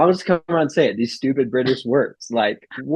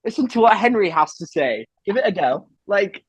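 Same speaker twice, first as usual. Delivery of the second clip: lightly put-on British accent, coaxing; a chummy Brit egging on his friend; 3 semitones higher than usual. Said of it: "In a British accent".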